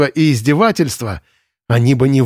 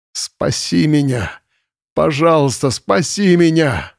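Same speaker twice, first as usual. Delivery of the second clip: slow, desperate begging; nervous pleading; 4 semitones higher, slower than usual. A narrator's reading. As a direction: beg slowly, nerves fraying. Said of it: "умоляющим нервным голосом". (умоляющим нервным голосом)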